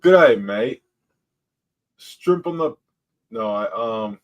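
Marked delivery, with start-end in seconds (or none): 0.0-2.7 tentative attempt at an Australian accent; unsure Australian greeting. Shown as a demonstration. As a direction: reach for an Aussie accent, higher pitched. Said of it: "Okay, do it".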